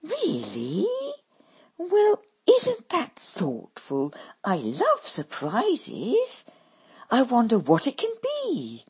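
slow, high put-on posh delight; affected slow delight; affected exclaiming. A narrator's reading. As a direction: gush over the surprise. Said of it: "her sister exclaims".

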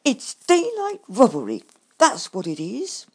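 loud, indignant complaint; disgusted outrage. A narrator's reading. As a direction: fume about being cheated. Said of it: "I snorted in disgust".

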